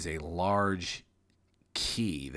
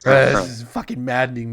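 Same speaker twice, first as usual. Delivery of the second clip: normal talking voice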